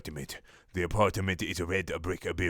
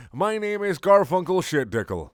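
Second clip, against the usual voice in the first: ordinary talk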